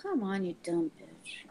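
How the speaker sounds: a low voiceless mutter at the screen; whispered grumble. Real, not acted. Waiting on the book search, whispering curses at it. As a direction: hiss it under your breath, annoyed.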